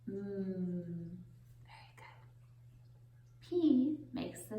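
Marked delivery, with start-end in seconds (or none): 1.6-2.2 whispered. Real, not acted. Praising children softly.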